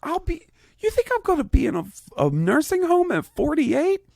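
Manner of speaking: very high, fast, laughing outrage; incredulous squeak. Real, not acted. Host teased about a nursing home, squeaking in laughing outrage. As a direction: shoot up into a squeaky laughing protest.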